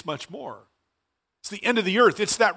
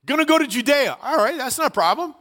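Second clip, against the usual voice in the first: higher-pitched, mock-confident acceptance; agreeable disciples; 6 semitones higher than usual. Disciples accepting Jesus' order to go to Judea, breezily agreeable. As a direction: play disciples readily agreeing to the task. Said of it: "I think the disciples went".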